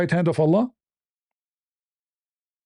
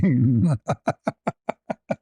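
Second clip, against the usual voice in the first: low staccato villain chuckle; taunting drawn-out laugh; mocking cackle; 5 semitones lower than usual. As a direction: chuckle slowly and mockingly at an opponent. Taunts his imagined opponent.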